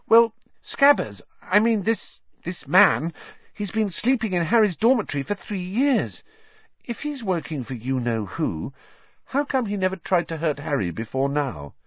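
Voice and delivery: put-on high, hesitant girl's voice; Hermione, tentative. A narrator's reading. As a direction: reason it out timidly, stumbling at first. Hermione voiced in story narration.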